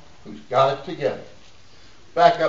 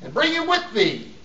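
high, suspended phrases with long pauses; emphatic scripture reading; 10 semitones higher, slower than usual. Acted. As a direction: hold the congregation on each word. Declaims scripture to congregation.

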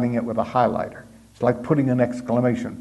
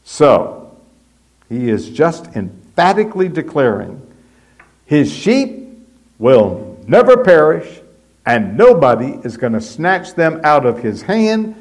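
high, loud pulpit build; fervent preaching; 3 semitones higher, 4 dB louder than usual. Acted. Preacher declaring the sheep are safe, fervent. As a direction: preach it home with fire.

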